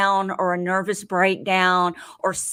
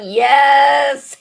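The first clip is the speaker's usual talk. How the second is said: excited shout; 6 semitones higher, 10 dB louder than usual. Real, not acted. Excited exclamation of approval.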